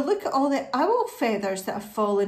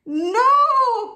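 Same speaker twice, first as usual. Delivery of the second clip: very high, loud, drawn-out plea; high begging wail; begging cry; 12 semitones higher, 9 dB louder than usual. Acted. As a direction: plead loudly like a storybook sheep. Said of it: "begged the sheep".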